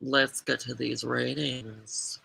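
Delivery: soft slow whisper. Acted. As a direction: whisper it conspiratorially. Whispered reply to cue.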